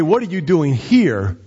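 slow question leaning on HERE; stress on HERE. Shown as a demonstration. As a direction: land on "here" as the surprising word. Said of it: "this is the last place that I would have expected you".

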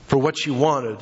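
ordinary talk, nothing marked

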